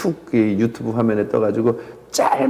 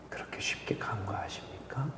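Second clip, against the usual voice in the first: whisper; 3 semitones lower, 16 dB quieter than usual. Acted. Urgent hushed rebuke.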